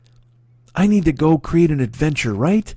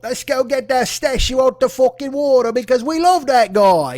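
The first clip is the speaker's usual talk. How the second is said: mock dumb dude; 6 semitones higher, 3 dB louder than usual. Mocking the statue rescuers in a dopey guy voice. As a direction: ham up a dim statue lover.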